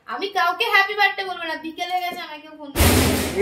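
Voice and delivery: loud, sulky grumble, mimicking mother; angry complaint. Son retells his mother's grumble, loud mock anger. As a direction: act out mother's upset birthday complaint. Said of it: "রেগে গিয়ে বলছে".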